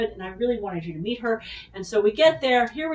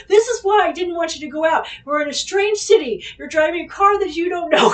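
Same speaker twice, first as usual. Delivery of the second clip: protective scolding; 6 semitones higher, 5 dB louder than usual. Retold or imitated. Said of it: "He's like".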